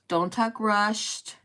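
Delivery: drawn-out, very slow words; slow and unhurried. Shown as a demonstration. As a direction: show how to talk slowly. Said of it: "Talk slowly".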